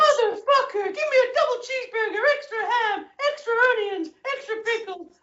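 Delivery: high, loud ranting wrestling manager voice; Jim Cornette impression. A podcast guest's impression for the hosts, a high angry rant. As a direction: yell the food order like a furious wrestling manager. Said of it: "Jim Cornette, as done by Bruce Pritchard".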